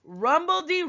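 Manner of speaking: rumbledy rolled out with energy; lively sound word. Pretend. Teacher reading the poem to her class, rumbledy called out lively.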